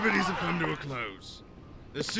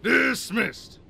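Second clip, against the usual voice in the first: louder and higher, a curt barked command; barked order; 6 semitones higher, 6 dB louder than usual. Acted. Sergeant dismissing the failed recruits, barked order. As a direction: snap the word out like a drill sergeant.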